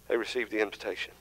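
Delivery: soft, fast echo of the key line; quiet quick echo. Shown as a demonstration. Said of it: "Because they received the invitation".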